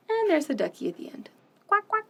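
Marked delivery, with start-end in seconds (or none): none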